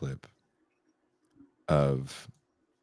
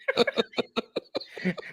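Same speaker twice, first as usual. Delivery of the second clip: airy laugh; 4 dB quieter than usual. Real, not acted. Laughing at the bit.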